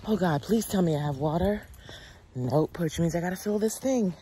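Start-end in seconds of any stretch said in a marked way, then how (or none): 0.1-1.6 puffing a little between words; out of breath. Real, not acted. Vlogger winded while walking, a little out of breath. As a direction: talk while short of breath. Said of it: "I'm a little bit out of breath".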